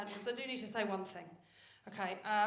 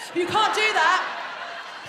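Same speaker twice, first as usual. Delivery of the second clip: ordinary talk, nothing marked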